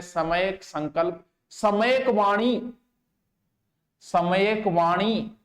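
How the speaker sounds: सम्यक संकल्प drawn out slowly, repeated; slow dictation. Shown as a demonstration. Teacher dictating the eightfold path slowly to note-takers. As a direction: dictate each term slowly and clearly. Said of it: "दो तीन बार बोलूं मैं".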